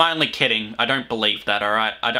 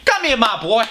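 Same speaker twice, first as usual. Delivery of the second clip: put-on old film patron voice; 8 semitones higher, 3 dB louder than usual.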